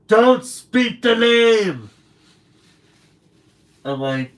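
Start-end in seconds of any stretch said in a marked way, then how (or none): 0.1-1.9 high, loud and slow, hammed-up horror; forbidding cry. Coworker mock-shrieking at him over the rival name. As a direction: shriek it like a forbidden spell was spoken.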